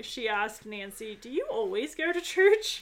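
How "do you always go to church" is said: high, louder, over-eager question; hammed-up zealous inquiry; verve and vigor. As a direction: ask it like a keen evangelist. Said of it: "With all the verve and vigor".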